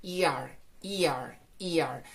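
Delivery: E R as one slurred er; er sound demo. Shown as a demonstration. Teacher drills the er sound for students. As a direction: repeat a drawn er sound slowly. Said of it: "vamos a pronunciar".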